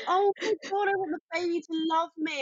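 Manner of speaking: high mock coo of friends fawning over a baby; mocking gushing friends. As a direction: gush over the baby in mockery. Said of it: "they were all being like".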